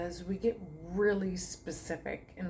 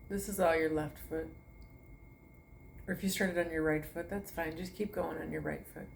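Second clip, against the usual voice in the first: guided meditation; 5 dB quieter than usual. Acted. To her online class, soothing meditation guidance. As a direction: talk them gently from toe to foot. Leads a toe-to-head relaxation.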